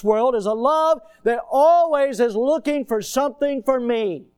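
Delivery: building preaching shout. Acted. Preacher warning the congregation of the devil's love, loud. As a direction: preach it at full force.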